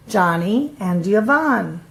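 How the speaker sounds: ordinary delivery, nothing marked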